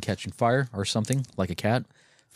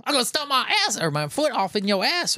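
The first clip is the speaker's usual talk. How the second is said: high put-on voice of a bar thug; a hammed-up barroom threat, pitched high; mock tough guy; 13 semitones higher than usual. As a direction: squeak out the threat like a cartoon brawler. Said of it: "He, he".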